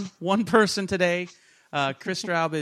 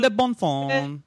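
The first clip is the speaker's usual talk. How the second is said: hammed-up mock French; 2 semitones lower, slower than usual. Cohost riffs nonsense French words in a mock accent. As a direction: play a cartoon Frenchman.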